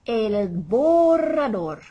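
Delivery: slow, loud "borrador" with a long trilled RR; rolled R's. Shown as a demonstration. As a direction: roll the RR in "borrador" as long as you like. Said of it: "just use those rolling R's all we want".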